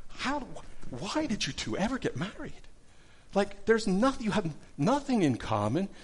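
exasperated, high-pitched incredulous counselor; frustrated. Retold or imitated. Counselor at wit's end with a mismatched couple, frustrated. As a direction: throw up your hands in baffled frustration, voice climbing high. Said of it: "he finally, in frustration, he goes".

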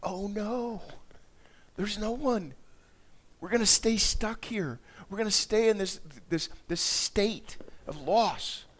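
high-pitched, dismayed wail without tears; despairing lament. Acted. Preacher acting out John weeping at the sealed scroll, despairing. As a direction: lament it as if all is lost. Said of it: "this place of despair".

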